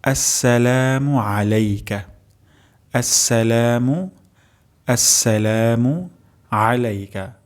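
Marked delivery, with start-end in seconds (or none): 0.0-6.1 আসসালামু drawn out slowly, each syllable clear, the doubled s held; slow Arabic recitation. Shown as a demonstration. Teacher models the first word of tashahhud slowly. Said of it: "প্রথম শব্দটি হলো".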